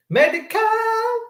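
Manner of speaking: Medi-Cal in a high, long-held squeal; mocking echo. Shown as a demonstration. Host jeers at the benefit name in the letter, mocking echo. Mocking a word just read.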